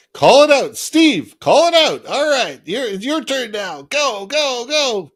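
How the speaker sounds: high, loud urging shout; rallying call-out. Acted. Crew member passing the cadence to Steve mid-boat, calling out. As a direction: holler it to spur the rowers on.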